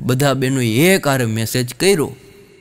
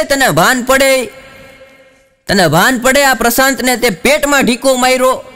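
scolding teacher; 9 semitones higher, 3 dB louder than usual. Retold or imitated. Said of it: "ખીજાતા હતા".

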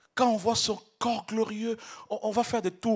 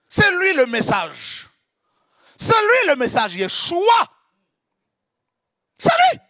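rising ever higher and louder to a shout; building proclamation; 10 semitones higher, 13 dB louder than usual. Real, not acted. Preacher proclaiming Yeshua to the congregation, building to a shout. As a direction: build C'est lui into a climactic cry.

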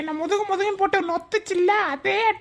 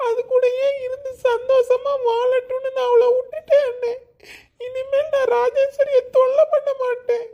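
fake sobbing; 3 semitones higher than usual. Fakes heartbreak to trick brother.

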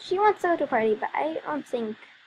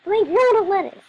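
high, loud airhead voice asking silly questions; high, loud mock-ditzy voice for the cooking character; clueless cook; 11 semitones higher, 7 dB louder than usual. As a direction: play a clueless girl who can't cook. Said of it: "she's like".

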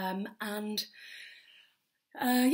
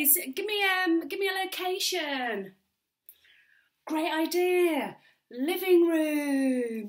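higher-pitched, hammed-up comedy compère; over-eager improv host; 5 semitones higher, 6 dB louder, slower than usual. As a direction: work the imaginary crowd with big put-on enthusiasm. Plays improv show host.

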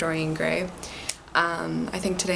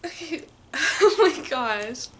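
laughing; 7 semitones higher than usual. Real, not acted. Opening gift note, laughing.

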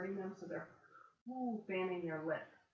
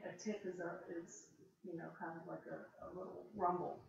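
ordinary talk, nothing marked

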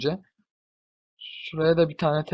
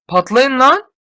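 higher, louder "Patlayın lan"; excited shout; 8 semitones higher, 8 dB louder, slower than usual. Real, not acted. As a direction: yell at the blast. Cheering explosions. (heyecanlı bağırış)

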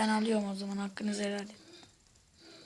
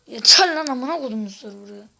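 furious shout; 9 dB louder than usual. Real, not acted. Raging after falling again.

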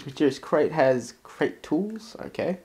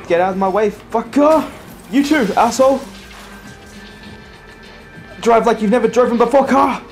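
loud, high road-rage yelling; yelling at traffic; 8 semitones higher, 5 dB louder than usual. Real, not acted. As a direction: shout at the other drivers. Yelling at traffic while driving.

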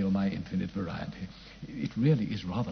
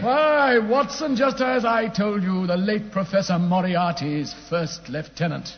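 triumphant reveal; 9 semitones higher, 4 dB louder, slower than usual. Acted.

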